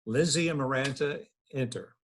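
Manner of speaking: ordinary talk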